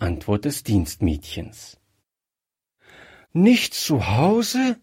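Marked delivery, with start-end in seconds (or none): 3.3-4.8 put-on slow, higher dismayed call of a girl; a touch of panic, raised and slow; desperate cry. A narrator's reading. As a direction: cry it out in despair, drawn out. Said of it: "rief Gretchen verzweifelt".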